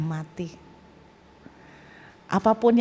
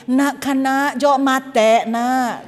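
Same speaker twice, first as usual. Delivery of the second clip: drawn-out Madurese accent, pitched up; 3 semitones higher, 5 dB louder, much slower than usual. Shown as a demonstration. Showing how the neighbour would say it, Madurese accent. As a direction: imitate a neighbour's thick Madurese lilt. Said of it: "Kalau logatnya Madura kan gini".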